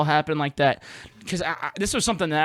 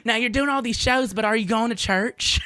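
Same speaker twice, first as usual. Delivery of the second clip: higher Southern aunt scolding lightly; 6 semitones higher than usual. Retold or imitated. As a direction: nag him gently about church. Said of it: "she'll be like".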